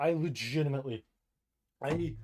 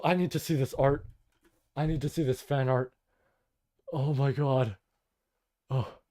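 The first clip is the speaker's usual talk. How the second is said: ordinary talk, nothing marked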